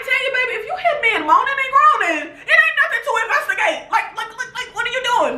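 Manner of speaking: high, fast, laughing plea; pleading with Tanya. Retold or imitated. Fan begging Tanya not to follow noises, high laughing plea. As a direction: urge her not to go investigate, half laughing. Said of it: "And I'm like".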